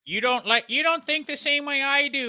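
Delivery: high, put-on whiny scold; mocking cancel-culture voice. Mocking the cancel crowd on air, high whiny scold. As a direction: sneer it like an outraged online mob.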